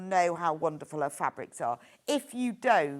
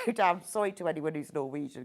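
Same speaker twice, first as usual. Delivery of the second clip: ordinary talk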